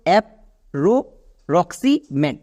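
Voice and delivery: approximate broken into separate chunks; teacher sounding out a word in parts. Shown as a demonstration. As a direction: say app, রো, Roxie, মেড one piece at a time. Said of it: "তাহলে কি হলো".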